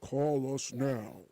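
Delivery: slow, low ad tag voice; drawn-out, put-on little ad voice; one little voice. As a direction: tack it on like the end of a commercial. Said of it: "they had that one little voice".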